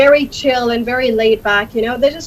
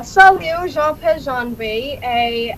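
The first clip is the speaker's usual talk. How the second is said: French; much slower than usual. Shown as a demonstration. Guest demonstrating languages for the host, speaking French. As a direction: introduce yourself in French.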